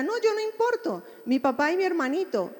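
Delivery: put-on high, lightly childish voice; imitating the little sister. Therapist imitating the grieving little sister, high childish voice. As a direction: pitch it up like a small girl putting others first. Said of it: "Entra la hermanita".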